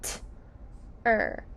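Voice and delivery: "T-er" as a crisp t, a gap, then er; "T-er" in two separate sounds; sounding out. Shown as a demonstration. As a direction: tap out "T", pause, then say "er". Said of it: "Sound ter".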